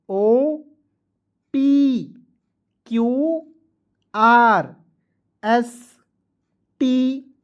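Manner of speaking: teacher dictating letters one by one; measured classroom recitation; slow alphabet drill. Shown as a demonstration. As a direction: call each letter out clearly with pauses.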